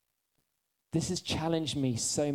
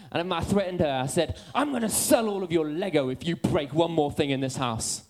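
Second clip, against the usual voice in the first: fired-up sermon voice, much higher and louder; full-force preaching; 4 semitones higher, 5 dB louder than usual. Acted. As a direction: preach it high and loud, pushing hard. Preaching continues.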